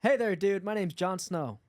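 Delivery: put-on American dude accent. Shown as a demonstration. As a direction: do a breezy mock American accent. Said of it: "can you do American".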